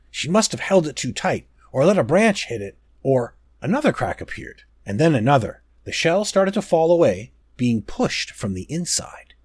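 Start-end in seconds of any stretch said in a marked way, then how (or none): none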